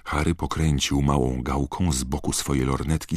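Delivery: usual speaking voice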